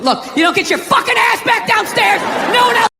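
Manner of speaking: high-pitched mock dad yell; hammed-up parental threat; snapping father. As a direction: lose patience and order the kid downstairs. Acts father losing patience.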